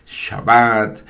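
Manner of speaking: shabBAT, Shabbat with a long stressed last syllable; final-syllable stress. Shown as a demonstration. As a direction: draw out the "bat" of Shabbat slowly. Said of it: "el acento recae siempre en la última sílaba".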